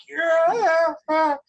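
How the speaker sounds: strained, high gasping shout; breathless cry. Retold or imitated. Actor onstage with no breath left, straining out a high cry. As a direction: force it out with no air left. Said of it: "I have no breath".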